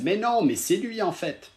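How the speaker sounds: high-pitched mock realization; imitated amazed viewers. Streamer quoting his viewers who recognise him, imitated amazement. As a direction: voice the onlookers' disbelief, pitched right up. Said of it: "ils se disent".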